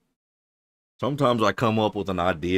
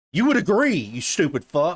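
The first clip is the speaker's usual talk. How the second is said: exasperated outburst; 8 semitones higher, 4 dB louder than usual. Real, not acted. Ranting at the coach's logic, loud exasperated outburst. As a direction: blow up at the sheer stupidity.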